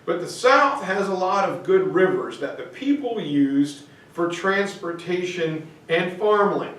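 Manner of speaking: ordinary talk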